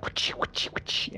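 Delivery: whispered sword sound. Retold or imitated. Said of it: "it's just like".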